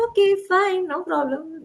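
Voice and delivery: put-on high, light little girl's voice, unbothered; breezy child voice. Daughter brushing off her mother's apology in a high child voice. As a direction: toss it off like a kid who's already moved on. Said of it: "And they'll be like".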